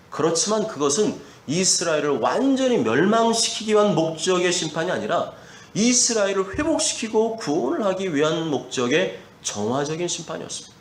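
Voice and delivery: ordinary talk, nothing marked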